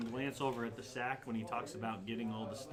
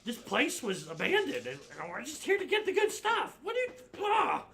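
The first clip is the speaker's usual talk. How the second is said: high, loud goblin complaint, climbing higher; exasperated goblin; 12 semitones higher, 5 dB louder than usual. Acted. Goblin explaining the looting, exasperated high voice. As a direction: rant upward into an exasperated cry.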